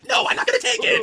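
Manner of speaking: very high, loud shriek; shrieked refusal. Acted. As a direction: screech it out in a fury. Medusa rejected, shrieking.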